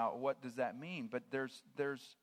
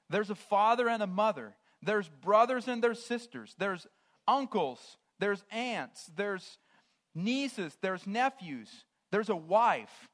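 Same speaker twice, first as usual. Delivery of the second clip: higher and louder, each family member ringing out; fervent preaching; 6 semitones higher, 10 dB louder than usual. Acted. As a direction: call out each mourner with force. Listing the grieving family members.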